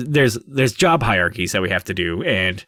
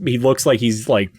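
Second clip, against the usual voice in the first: ordinary delivery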